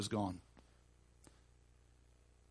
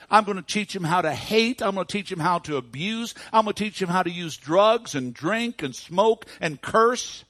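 loud, high, mounting list of wicked plans; gloating, boasting; 8 semitones higher, 9 dB louder than usual. Retold or imitated. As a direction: crow each item with devilish relish. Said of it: "he was gloating, and he was boasting".

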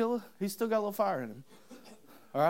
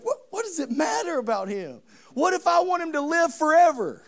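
higher and louder as Jesus; raised rebuke; 9 semitones higher, 7 dB louder than usual. Acted. Jesus rebukes Peter for asking about John, loud. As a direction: push back hard on the question.

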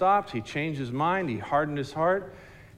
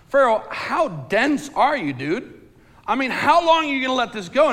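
higher, louder, incredulous officials; exasperated officials; 9 semitones higher, 6 dB louder than usual. Acted. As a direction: officials pressing their stubborn king, exasperated. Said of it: "looking at Pharaoh going".

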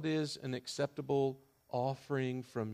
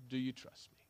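softer, gentle plea; tender question; 5 dB quieter, faster than usual. Acted. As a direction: drop to a soft, personal ask. God's gentle question after climax.

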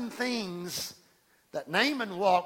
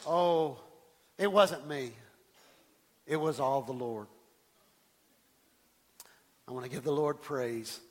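false humility; 8 semitones lower than usual. Retold or imitated. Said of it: "I would say things like this".

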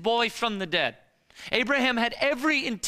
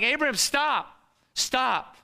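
higher, raised cry of stop; urgent call; 5 semitones higher, slower than usual. Acted. Angel halts Abraham mid-strike, raised urgent call. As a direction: call out sharply to stop his hand.

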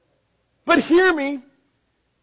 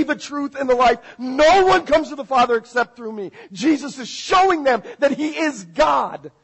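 raised, high-pitched sermon delivery; preaching at full force; 6 semitones higher, 6 dB louder than usual. Real, not acted. Preacher proving to the congregation that Jesus is God, forceful and loud. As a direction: drive each verse home loudly.

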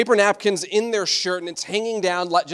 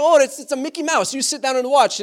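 high, loud doting grandma voice; hammed-up grandma, pitched well up; imitated Italian grandma; 8 semitones higher, 5 dB louder than usual. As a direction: play an insistent grandma ushering the kids to watch. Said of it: "And my grandma would be like".

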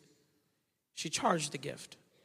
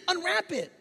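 ordinary delivery

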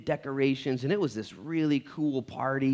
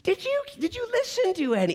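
much higher, slow incredulous asking; Jesus exasperated at his disciples; exasperated disbelief; 10 semitones higher than usual. Retold or imitated. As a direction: ask like a teacher whose students missed the whole point. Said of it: "Jesus looks at them like".

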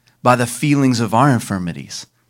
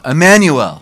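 louder, higher call of the name Emmanuel; said aloud; 4 semitones higher, 7 dB louder than usual. Shown as a demonstration. Preacher calling the congregation to say Emmanuel, loud. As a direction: ring out Emmanuel to the room. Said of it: "Say it aloud".

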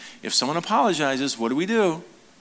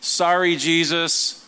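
mock guilty bystander; 6 semitones lower than usual. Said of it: "someone ... at the foot of the cross going".

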